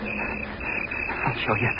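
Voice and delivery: slow, breathy whispered threat; whispered vow. Acted. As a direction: hiss it under your breath. After outburst, whispered vow.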